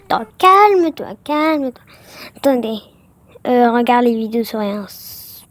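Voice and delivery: ordinary talk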